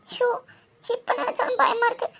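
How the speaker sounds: crying out the fear of losing Karthik; tearful. Acted. Friend weeps to friend about losing her love, voice breaking. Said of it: "இப்ப நீயும் இப்படி அழுதுட்டு இருந்தேன்னு".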